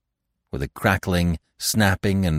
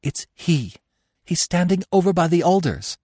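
terrified; 11 semitones higher than usual. A narrator's reading. Marina terrified after fleeing.

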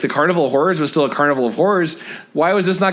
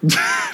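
high squeal laugh. Real, not acted. Laughs at dig it up.

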